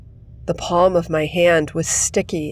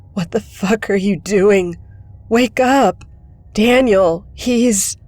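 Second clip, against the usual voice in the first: higher and louder rising shout; desperate yell; 4 semitones higher, 5 dB louder than usual. Acted. Kid yelling at Sam to wake up, desperate shout. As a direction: shake him awake with your voice. Kid yells at British friend.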